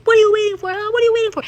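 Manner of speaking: high, loud taunting challenge; imitating the actress. Retelling the movie scene, high loud taunt. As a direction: dare them with arms wide. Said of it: "she stomps ... and she goes".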